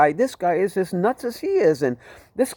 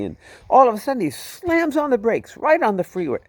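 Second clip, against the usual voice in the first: ordinary delivery, nothing marked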